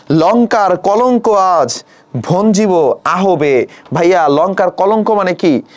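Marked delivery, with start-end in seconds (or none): 0.1-3.5 slow, measured verse recitation with long pauses; poem recitation. Shown as a demonstration. Teacher reciting the verse to students, slow and measured. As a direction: draw out each word as if reading poetry aloud. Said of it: "teacher reciting the verse line".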